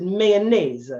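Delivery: "Mayonnaise" said as may-o-nay-ez in a mock Italian voice; four-syllable mayonnaise. Italian guest proving mayonnaise has four syllables, mock accent. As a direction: spell out "Mayonnaise" in four beats. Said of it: "And they said".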